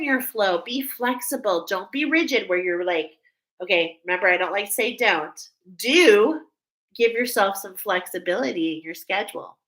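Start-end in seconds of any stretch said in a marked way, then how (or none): 5.8-6.4 "Do" punched loud and higher; emphatic do. Shown as a demonstration. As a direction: hit the word "Do" hard as the positive choice. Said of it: "do you see how I just did that".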